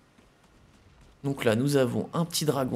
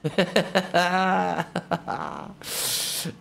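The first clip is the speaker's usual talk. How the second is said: laughing; 6 semitones higher than usual. Real, not acted. Laughing at the cramped animal.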